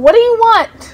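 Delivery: loud high yell. Real, not acted. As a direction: snap at them loudly. Yelling at someone off camera.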